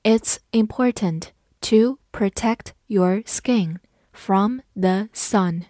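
slow, deliberate read-along. Shown as a demonstration. Teacher reads slowly for class to follow. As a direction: read it slowly word by word for students. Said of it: "跟着我慢读一遍".

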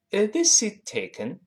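"Is this seat taken" with "this" high and rising; a clear teacher's model question, "this" lifted high. Shown as a demonstration. Teacher models the English question for students, bright rising tone. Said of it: "我们应该这么说".

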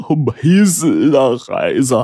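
put-on slow, tearful old father, pitched high; choked up. A narrator's reading. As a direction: force the words out through a choking sob. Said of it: "哽咽地说".

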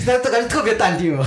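笑着调侃, 话里带笑; 一边笑一边说; 边笑边说. Real, not acted. 笑着调侃对方. (笑着说)

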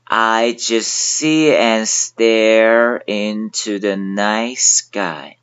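very slow, drawn-out reading; 极慢带读; very slow read-along. Shown as a demonstration. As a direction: drag each word out for learners. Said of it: "现在跟我一起来读".

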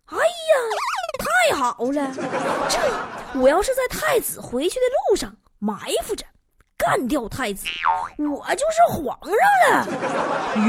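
high, drawn-out scheming glee with a laugh; gleeful schemer. Retold or imitated. Prince plotting an ambush on the crown prince, slow gleeful scheming. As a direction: savour the evil plan, stretching the last words slowly. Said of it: "朱高煦一看，".

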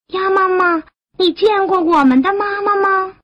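put-on high little-child voice; tadpole children. Tadpoles asking Mother Duck for help, high and childlike. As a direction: pipe up with an eager, high question.

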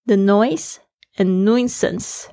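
"the noise and nuisance" read slowly, each word clear; teacher's slow model of "the noise and nuisance". Shown as a demonstration. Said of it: "后面就讲到两个东西".

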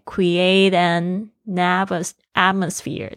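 slow "create an atmosphere", the n held into "natmosphere"; exaggerated linking. Shown as a demonstration. Teacher pointing out the liaison, exaggerated linking. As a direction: stretch the n of "an" onto "atmosphere". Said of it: "好像有一个连音".

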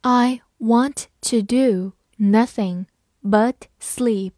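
slow, word by word; slow and clear, each word apart; slow reading. Shown as a demonstration. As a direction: read it slowly for learners. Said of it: "好，慢慢来读。".

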